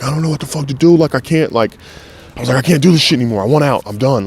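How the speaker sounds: high, tearless reenacted desperation; retold crying. Crying to his pops that he can't go on, distraught. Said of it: "I'm crying, I'm like".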